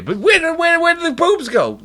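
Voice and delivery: cartoonish where-did-they-go; mock bewilderment. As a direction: gasp it like a baffled guy. Mock bewildered search for boobs.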